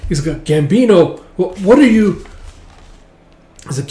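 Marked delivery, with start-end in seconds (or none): 0.4-2.2 loud, high stammered question; acted shocked outburst; startled alarm. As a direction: cry out at a sudden attacker. Startled by night attack.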